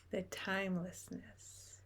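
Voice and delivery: ordinary talk, nothing marked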